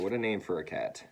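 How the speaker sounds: plain reading voice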